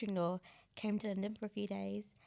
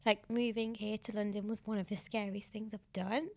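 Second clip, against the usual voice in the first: usual speaking voice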